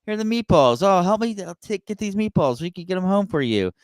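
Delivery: high-pitched imitation of a fussing aunt; Italian aunt. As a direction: play the aunt pressing food on you. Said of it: "your aunt says".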